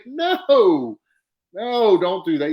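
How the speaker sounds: retelling his nervous plea as a rookie; nervous pleading. Retold or imitated. Telling Macho Man not to watch his match, nervous.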